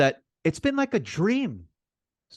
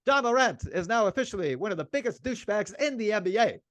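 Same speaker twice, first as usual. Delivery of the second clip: mock award announcement; 7 semitones higher than usual. Real, not acted. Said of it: "which I'm nominating him right now".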